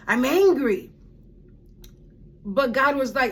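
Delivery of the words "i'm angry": a higher, mildly indignant outburst; retold anger. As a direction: declare it with a touch of temper. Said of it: "I just was like".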